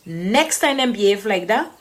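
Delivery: ordinary talk, nothing marked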